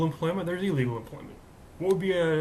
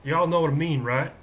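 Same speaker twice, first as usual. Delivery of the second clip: louder, showy Texas accent; 2 semitones higher, 6 dB louder than usual. Shown as a demonstration. Host demonstrating for viewers, put-on Texas accent. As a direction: show off your Texas twang. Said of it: "I can do a Texas accent".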